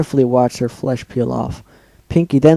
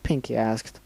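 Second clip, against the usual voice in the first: ordinary delivery, nothing marked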